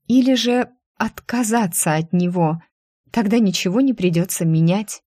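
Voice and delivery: usual speaking voice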